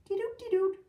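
high piping toots; imitated pipe music. A narrator's reading. Piper playing down the street, high imitated tooting. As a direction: toot it like a little pipe. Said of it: "Amanda heard music".

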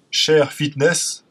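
slow, careful English accent on a brand name. Shown as a demonstration. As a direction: say it the English way, drawn out. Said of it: "Putain, j'ai un accent anglais".

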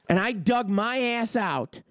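ordinary talk, nothing marked

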